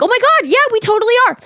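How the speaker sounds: very high-pitched imitation of eager agreement; high, gushing mock enthusiasm; retold excited agreement. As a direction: squeal in recognition, quoting someone. Retells woman's excited agreement.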